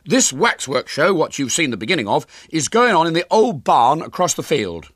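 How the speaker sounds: loud, high, dignified address; great dignity. A narrator's reading. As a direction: address the hall as an injured showman. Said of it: "he said to his audience with great dignity".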